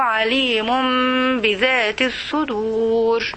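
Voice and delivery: slow, measured chanted recitation; slow tajweed-style reading; Quran recitation. Shown as a demonstration. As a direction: recite the verse with reverence, drawn out. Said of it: "اقري لي بقى الآية".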